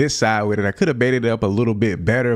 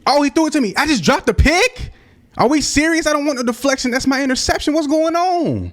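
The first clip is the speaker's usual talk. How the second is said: high, loud shriek of real disbelief; outraged disbelief; 3 dB louder than usual. Real, not acted. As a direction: yelp in outrage at the dropped pick. Teammate drops his interception.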